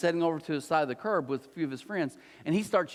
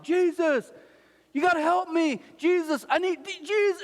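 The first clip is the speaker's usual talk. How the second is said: high-pitched, louder pleading cry; desperate loud calling; 12 semitones higher, 4 dB louder than usual. Acted. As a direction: call out for help over a crowd. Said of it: "this one guy getting loud".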